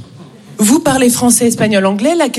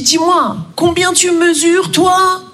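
higher, slow wary question; mock macho quizzing; suspicious guy; 4 semitones higher, slower than usual. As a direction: size her up with suspicion. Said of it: "il me dit".

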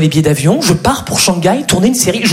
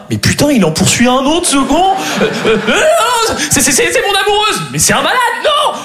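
panicked; 8 semitones higher than usual. Retold or imitated. Panicked shouting at agents.

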